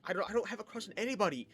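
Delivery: fast, high flustered denial; imitating a nervous kid's protest; nervous, scared. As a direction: blurt it out like a cornered shy kid. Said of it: "I'm very nervous. I'm scared".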